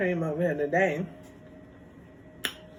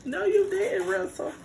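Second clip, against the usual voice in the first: high no you didn't, Russell; mock outrage; 13 semitones higher than usual. Real, not acted. Calling out Russell in high mock outrage. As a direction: squeal it at him in mock shock.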